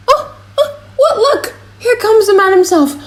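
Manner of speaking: put-on very high, excited old man's cry; an old man flustered, pointing out the master; startled old father.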